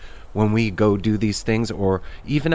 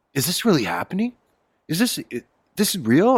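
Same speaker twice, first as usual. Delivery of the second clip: incredulous; 7 semitones higher than usual. Retold or imitated. Doubting at Dead shows.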